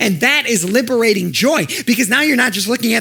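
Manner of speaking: high, loud, building preacher voice; preaching at full force. Acted. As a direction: lift the crowd with rising joy. Preaching climax with music.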